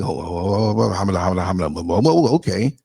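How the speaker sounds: low, flustered hamada stammer; Ralph Cramden stammer. Retold or imitated. Lightly voiced sitcom husband, flustered at the couple's news. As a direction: sputter like a caught-off-guard sitcom husband. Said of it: "doing the Ralph Cramden, hamada, hamada".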